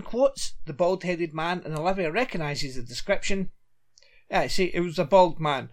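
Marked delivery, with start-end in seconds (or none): none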